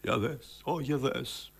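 soft, understated amazement; hushed surprise. Shown as a demonstration. Director models the startled reaction, softly surprised. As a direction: marvel quietly, with a touch of fear and pleasure. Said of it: "Και φόβος και ευχαρίστηση".

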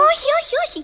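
very high, urgent cry of no; startled, high-pitched protest; alarmed warning. Acted. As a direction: stop them before they get hurt. Warning Duchess away from bird.

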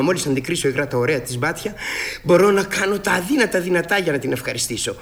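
usual speaking voice

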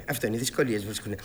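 fast, flustered repetition of "αυτό είναι"; hurried repeat. Acted. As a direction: hurry through "αυτό είναι" as a nervous excuse. Repeats faster, nervous.

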